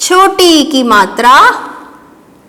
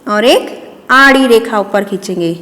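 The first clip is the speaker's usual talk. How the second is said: ordinary talk, nothing marked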